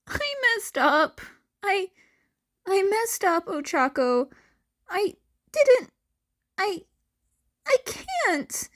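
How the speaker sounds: put-on high, strained young voice near tears; a boy forcing out a painful confession; Izuku's voice. A narrator's reading. Izuku's broken apology, narrated story.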